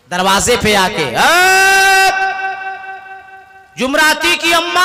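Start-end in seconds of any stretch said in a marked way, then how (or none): 1.0-4.9 high, loud call to his wife; husband calls wife from the door, loud high call. Acted. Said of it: "आवाज लगावे".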